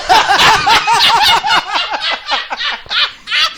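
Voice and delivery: long, loud burst of laughing; loud high laughter. Real, not acted. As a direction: howl with laughter. Big laugh at the heist joke.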